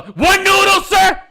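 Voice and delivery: very high, loud disbelief; incredulous shout. Retold or imitated. Worker to customer over one leftover noodle, incredulous shout. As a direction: yell it as an exasperated comic protest.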